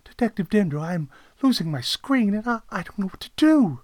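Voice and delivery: high, loud pleading client; worried caller at a loss; distressed caller. Acted. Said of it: "the voice on the other end said".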